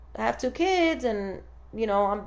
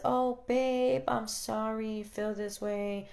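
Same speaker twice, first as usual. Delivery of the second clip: softer, slower, cooing pretend princess; mock fairy-tale comfort; 7 dB quieter, slower than usual. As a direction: coo it like a storybook wife soothing him. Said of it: "let's go back to this fairy tale, the princess".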